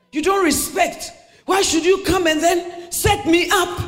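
high, loud indignant rant; hammed-up furious husband shouting; angry husband. Preacher acts angry husband's outburst.

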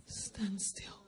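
a whispered, soft order; hushed command. Acted. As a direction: breathe the command. God answers calmly.

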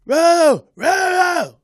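very loud, high-pitched yowls; heckler noise. Retold or imitated. Heckler starting up after security leaves, loud howling noises. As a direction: blare out wordless howls like a heckler. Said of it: "this is the kind of sounds he's making".

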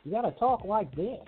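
high, soft, bass-free voice; put-on high, gentle voice; talk like this. Shown as a demonstration. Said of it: "too much bass in your voice".

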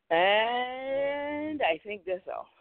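slow, high "And", then wind it up; drawn-out wrap-up. Real, not acted. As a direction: stretch the first word long and rising.

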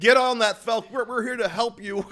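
high, urgent TV-doctor imitation; George Clooney. Imagined doctor rushes in with a gurney, mock urgent. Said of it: "George Clooney was gonna pop out".

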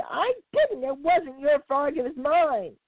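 high, insistent bird voice; high squawking denial. Acted. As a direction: squawk it, claiming the frog. Hawk and heron quarrel.

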